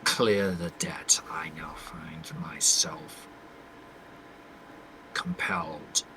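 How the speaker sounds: low, slow, hushed monologue with long pauses; restrained, burdened character. Acted. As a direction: speak softly and deep, holding it in. Plays his character's monologue.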